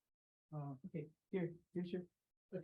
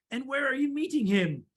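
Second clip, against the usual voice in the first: higher-pitched owl question; inquiring owl; 5 semitones higher than usual. Acted. Owl asks the mouse about the meeting, inquiring. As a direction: lift it as a curious owl.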